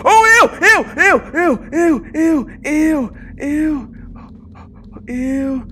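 high repeated ews, easing off; panicked squeal. Real, not acted. Player recoiling from moving figures, panicked squeal.

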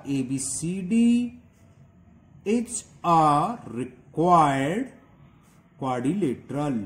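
slow, stretched dictation. Shown as a demonstration. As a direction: dictate the final step word by word. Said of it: "step five".

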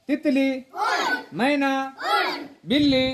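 loud, high game calls to the class; calling out. Real, not acted. Teacher calls out game words.

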